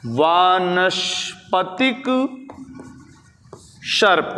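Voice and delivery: syllables drawn out, long pause between words; slow dictation. Shown as a demonstration. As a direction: stretch each word out for note-takers. Dictates key term slowly. (खींच-खींच कर, धीरे-धीरे लिखवाते हुए)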